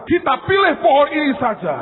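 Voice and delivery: high, loud call choosing the tree; loud woodcutter. Acted. Woodcutters choosing the second tree, calling loudly. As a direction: call out the pick like a rough cutter. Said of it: "mereka berkata".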